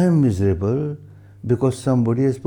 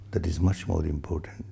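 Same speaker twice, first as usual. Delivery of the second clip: a soft unvoiced whisper, lower; whispered aside; 7 semitones lower, 9 dB quieter than usual. Real, not acted. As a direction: breathe it out as a confiding aside. Drops to an aside.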